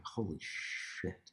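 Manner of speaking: breathless, hushed and slow; stunned whisper. Retold or imitated. Seeing [name] walk away, a slow awed whisper. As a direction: barely breathe it out in awe. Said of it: "and I was like".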